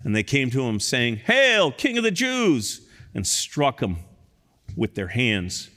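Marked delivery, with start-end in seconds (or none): 1.2-2.8 high, slow sneering mockery; soldiers' mock salute; mocking hail. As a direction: hail him as a joke king.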